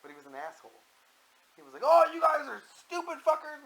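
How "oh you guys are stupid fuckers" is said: high, loud mocking imitation of a heckler; jeering caller. Host imitates the rude caller from Italy, jeering loudly. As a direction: hurl the insult in a put-on high voice. Mimics abusive caller talking shit.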